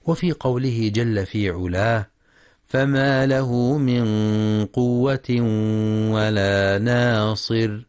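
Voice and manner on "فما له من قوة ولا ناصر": slow, measured tajweed chant; sustained, level-toned tajweed recitation; Quran recitation. Acted. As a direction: recite with long, held vowels and nasal hums.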